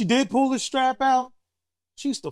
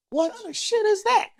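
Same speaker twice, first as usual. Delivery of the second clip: high-pitched mock disbelief; incredulous protest; 13 semitones higher than usual. Grown child questioning mom's old saying, incredulous.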